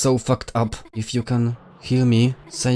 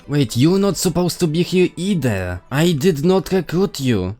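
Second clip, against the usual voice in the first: higher, louder character voice; 6 semitones higher, 5 dB louder than usual. Acted. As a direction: read it as the game character. Character line read aloud.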